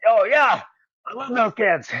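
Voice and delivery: big, high-pitched affectionate exclamation; enthusiastic imitation. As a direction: imitate a hearty, delighted endorsement. Imitating DDP greeting the kids.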